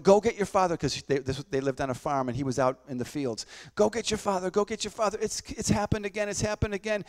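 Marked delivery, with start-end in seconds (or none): 0.0-0.8 raised, higher pleading call to fetch help; mild mock scream of a sick mother; retold panicked cry. Mother on the farm begging a boy, panicked call. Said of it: "into the room screaming". 3.7-7.0 higher, urgent mother's plea, lightly voiced; a hint of a frightened mother calling out; retold panicked plea. Sick mother begging for her husband, mild panicked plea. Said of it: "into the room screaming".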